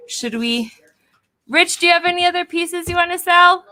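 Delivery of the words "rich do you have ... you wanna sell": calling out. Real, not acted. Calling across the room.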